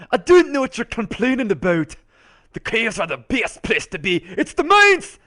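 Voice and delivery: excitable character voice, climbing to a high cry; put-on sidekick character. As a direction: protest as a quirky cave-loving sidekick. Second character voice answers back.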